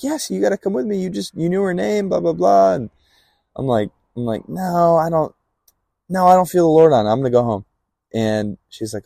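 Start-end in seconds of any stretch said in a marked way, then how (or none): 0.0-2.7 imitated high-pitched urging; insistent friend. Friend insists he come along. 4.5-7.7 retold protest, higher and louder; firm refusal. Retold refusal of friend's urging.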